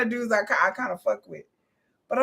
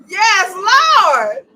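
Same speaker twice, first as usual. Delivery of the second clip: loud, high hallelujah cry; a real excited yes lord; hyped praise; 10 semitones higher, 10 dB louder than usual. Real, not acted. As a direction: shout it like church praise. Hyped by the dragon.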